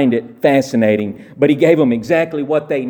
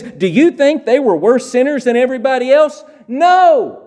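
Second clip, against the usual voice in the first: high, loud question with a shouted No; emphatic question and no; 9 semitones higher, 3 dB louder than usual. Retold or imitated. Preacher voicing Jesus asking the crowd, then crying No. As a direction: throw the question out and answer it hard.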